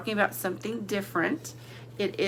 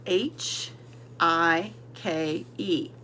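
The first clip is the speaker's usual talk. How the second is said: I K E spelled letter by letter, drawn out; spelling slowly; 2 semitones lower, much slower than usual. Shown as a demonstration. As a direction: spell I K E slowly for young learners. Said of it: "So let's spell it".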